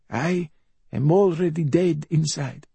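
high, self-pitying bedridden mother; high-pitched raspy invalid voice; rasping mother. A narrator's reading. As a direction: rasp it high, a mother playing at dying. Mother dramatising her own death.

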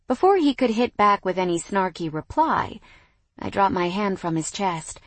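usual speaking voice

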